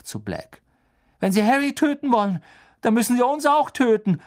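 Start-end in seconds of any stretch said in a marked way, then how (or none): 1.2-4.2 high, loud, grim defiance. Acted. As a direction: defy him through the pain. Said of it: "sagte er grimmig".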